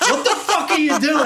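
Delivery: high, incredulous mock outrage; exasperated outburst. New Yorker mocking the driver, exasperated and high. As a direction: laugh it out in disbelief. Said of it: "I'm like".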